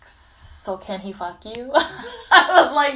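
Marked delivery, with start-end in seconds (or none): 0.0-1.7 soft, slow imitation with a laugh; hushed follow-up question. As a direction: imitate her asking slowly and quietly. Imitates the woman's persistent request.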